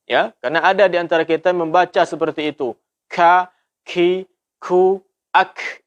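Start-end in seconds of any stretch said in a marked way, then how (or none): 3.1-5.9 ka ki ku ak with heavy breath; overdone aspiration. Shown as a demonstration. Teacher showing a common mistake, breathy. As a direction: blow out too much air on each. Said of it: "Ini hamasnya berlebihan".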